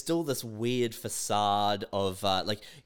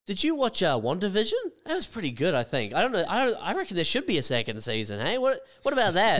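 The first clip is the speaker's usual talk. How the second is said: mock casual chit-chat; 6 semitones higher than usual. Mock small talk to stall.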